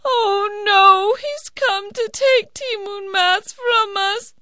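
put-on high, crying old woman, slow; high tearful mother's lament; grieving old woman. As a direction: wail in despair.

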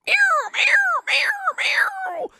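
very high, loud meows; cat meowing. Acted. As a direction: yowl like a hunting cat. Cat clawing at the mouse hole.

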